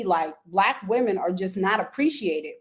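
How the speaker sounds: ordinary delivery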